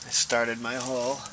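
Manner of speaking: usual speaking voice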